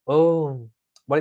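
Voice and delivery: ordinary delivery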